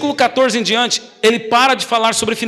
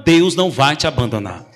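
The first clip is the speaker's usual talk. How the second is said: lower, gentle Deus não vai te abandonar; calm reassurance; 6 semitones lower than usual. Real, not acted. Preacher repeats the promise twice.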